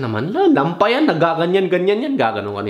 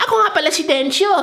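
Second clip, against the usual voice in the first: squeaky, much higher voice; silly high-pitched voice. Retold or imitated. Mocking a guy who introduces himself in a high-pitched voice. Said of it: "hindi ka high pitch magsalita".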